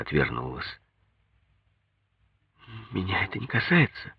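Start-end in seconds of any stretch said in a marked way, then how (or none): 2.9-4.1 put-on soft, higher-pitched young wife's denial; withdrawn denial. A narrator's reading. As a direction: quietly brush it off. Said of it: "сказала она".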